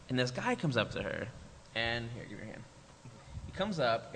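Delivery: ordinary delivery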